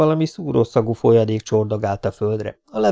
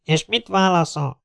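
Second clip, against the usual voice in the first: raised-pitch official question; demanding captain; 8 semitones higher than usual. Acted. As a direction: press for the answer over the loudspeaker. (választ követelő százados)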